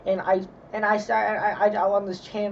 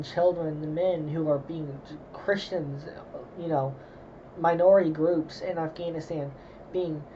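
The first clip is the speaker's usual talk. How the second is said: ordinary talk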